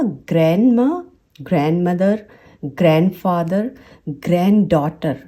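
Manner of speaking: "gran" with no D in ग्रैंडमा, ग्रैंडमदर, ग्रैंडफादर, ग्रैंडडॉटर; careful list, first D dropped in ग्रैंडडॉटर and ग्रैंडमदर; ग्रैंडमा as "gran-ma", the rest alike; silent D. Shown as a demonstration. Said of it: "ये होगा".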